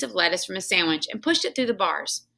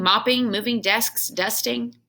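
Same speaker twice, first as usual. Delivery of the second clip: ordinary delivery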